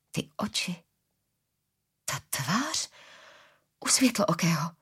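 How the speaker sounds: hushed, voiceless whisper of dawning recognition; stunned whisper. A narrator's reading. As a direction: whisper it as the realisation creeps in. Stunned recognition of a familiar face. (užaslý šepot)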